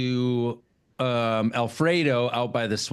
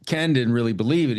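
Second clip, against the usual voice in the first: ordinary talk, nothing marked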